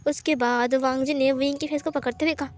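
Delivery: usual speaking voice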